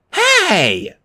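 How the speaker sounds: high, indignant cry; mock scream. A narrator's reading. Annabeth is yanked away from her game, crying out. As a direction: yelp in high-pitched protest. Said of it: "She screamed and hit me".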